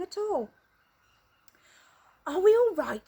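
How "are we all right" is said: higher, louder worried ask; nervous old man checking; anxious question. Acted. Grandpa Joe asks Wonka, high anxious. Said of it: "said Grandpa Joe".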